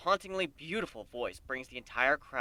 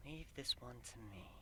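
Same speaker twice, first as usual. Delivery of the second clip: much softer voice in the mind; whisper; 3 semitones lower, 17 dB quieter than usual. A narrator's reading. Celestia whispering in the narrator's mind, much softer. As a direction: murmur it inside her head. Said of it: "Celestia whispers in my mind".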